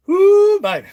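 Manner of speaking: very high, loud whooping shout; excited whoop. Real, not acted. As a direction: let out a big delighted woo.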